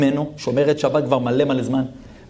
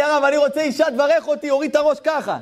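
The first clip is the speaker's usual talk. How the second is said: eager plea; 13 semitones higher than usual. Retold or imitated. Imitating eager young man.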